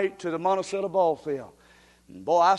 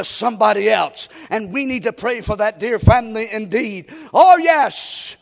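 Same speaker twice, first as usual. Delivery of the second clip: fervent preacher shouting blessing; preaching at full force; 3 semitones higher, 5 dB louder than usual. Acted. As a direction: rouse them, full voice.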